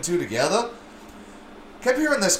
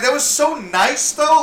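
mock charmed; 11 semitones higher, 5 dB louder, slower than usual. His own charmed reaction.

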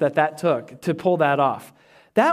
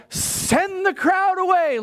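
high, drawn-out mock pleading; hammed-up whine, stretched out slowly; mock plea; 12 semitones higher, slower than usual. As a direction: imitate a pleading disciple, pitched way up. Mock disciples pleading with Jesus.

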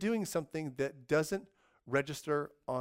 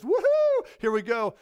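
whooping cheer; 10 semitones higher, 9 dB louder than usual. Retold or imitated. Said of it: "time traveler boy comes back and goes".

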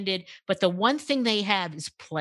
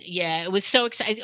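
ordinary delivery

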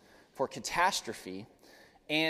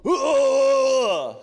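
loud, high drawn-out holler; imitated yell from next room; 11 semitones higher, 11 dB louder than usual. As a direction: holler out like dad crashing in the dark. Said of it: "I hear in the other room".

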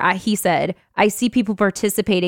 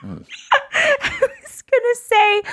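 laughing; much slower than usual. Real, not acted. Laughing at her own slip.

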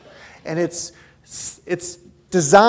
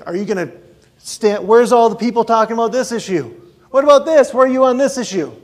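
loud, higher, pushy questioning with a laugh; demanding crowd; 5 semitones higher, 7 dB louder than usual. Retold or imitated. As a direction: badger him like an impatient crowd. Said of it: "so many people are saying".

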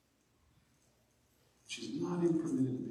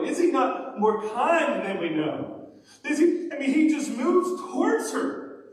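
loud, higher-pitched preacher delivery; full-force preaching; 10 semitones higher, 8 dB louder than usual. Real, not acted. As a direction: drive the point home to the congregation, loudly.

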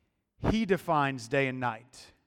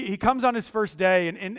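ordinary delivery

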